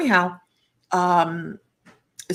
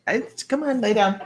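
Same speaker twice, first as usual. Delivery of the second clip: ordinary talk, nothing marked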